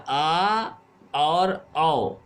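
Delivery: आ and औ stretched very slowly, one after the other; drawn-out vowel demo. Shown as a demonstration. Teacher showing students the vowel sound, very slowly drawn out. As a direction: sound out आ then औ, long and slow, between the two. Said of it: "के बीच में इसका उच्चारण होता है".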